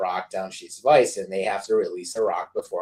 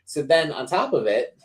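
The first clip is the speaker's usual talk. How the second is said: ordinary talk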